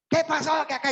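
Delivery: imitating an angry person shouting; angry yell. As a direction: storm in demanding answers.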